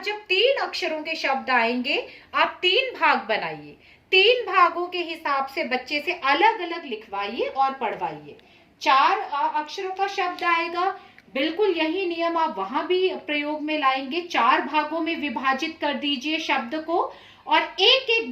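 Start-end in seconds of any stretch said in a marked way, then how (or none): none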